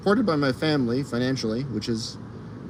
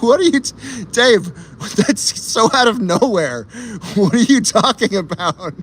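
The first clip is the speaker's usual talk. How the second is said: high, loud, stunned outburst; shocked disbelief; 8 semitones higher, 6 dB louder than usual. Real, not acted. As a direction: shout it, totally thrown. Shocked reaction to chat.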